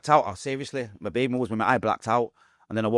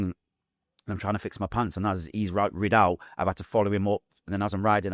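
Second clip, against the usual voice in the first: ordinary delivery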